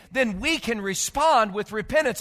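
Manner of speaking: normal talking voice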